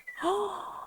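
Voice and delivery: high startled gasp; sharp gasp of alarm; shocked gasp. Acted. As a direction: gasp as if caught out. Reacts to hiding digger.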